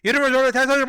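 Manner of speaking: high, rapid-fire angry yelling; imitating a caller screaming at him; screaming support guy. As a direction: scream the accusation in one garbled rush. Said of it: "guy from DoorDash screamed at me".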